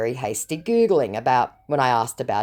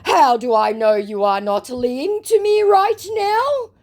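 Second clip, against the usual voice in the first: mock scammer voice; 11 semitones higher, 5 dB louder, slower than usual. Reading scammer's reply aloud.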